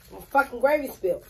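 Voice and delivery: real irritation breaking through the calm; annoyed outburst. Real, not acted. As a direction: blurt it out irritated. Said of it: "Now I'm mad".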